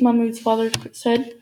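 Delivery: ordinary delivery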